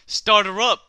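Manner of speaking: usual speaking voice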